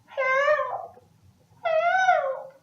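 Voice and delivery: put-on very high little baby calling out; baby sister calling for rescue from the dragon's cave; baby crying help. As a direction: squeak it out like a tiny baby in trouble. Baby calls out.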